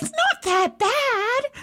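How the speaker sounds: very high, drawn-out strained squeal; unconvincing bravado. Retold or imitated. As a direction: squeak it out as if freezing but coping. Pretends the cold is bearable.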